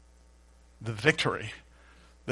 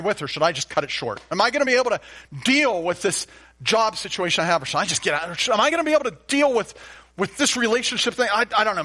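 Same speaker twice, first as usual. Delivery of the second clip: fretful, higher and louder worried believer; mock anxious voice, higher and louder; 6 semitones higher, 6 dB louder than usual. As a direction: rattle off doubts like a troubled man.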